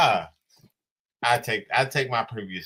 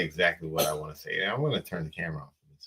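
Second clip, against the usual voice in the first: lower, softer and fast, leaning into the mic; hushed and close; 8 semitones lower, 8 dB quieter, faster than usual. Real, not acted. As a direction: confide it quickly up close. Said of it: "I wanna get in close".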